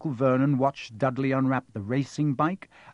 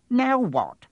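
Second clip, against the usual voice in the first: sharp, much higher furious outburst; furious aunt; 10 semitones higher, 4 dB louder than usual. A narrator's reading. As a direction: snap it at the boy in a rage. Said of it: "looking furiously at Harry".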